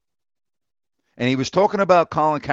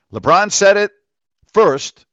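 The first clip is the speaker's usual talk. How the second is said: emphatic; 5 semitones higher, 5 dB louder than usual. Real, not acted. Insisting LeBron said it first.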